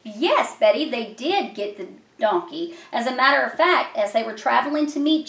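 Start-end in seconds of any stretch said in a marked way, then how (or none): none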